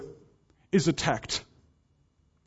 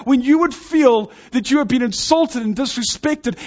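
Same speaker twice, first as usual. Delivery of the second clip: normal talking voice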